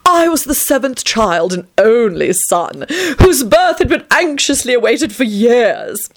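milk every boast like a vain lord; hammed-up self-adoring raconteur; vain vampire storyteller. A narrator's reading. Tony's boastful childhood tale.

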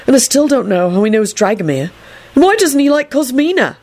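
high-pitched irritable complaint. A narrator's reading. Neville grumbling to Trevor, irritable. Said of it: "Neville told Trevor irritably".

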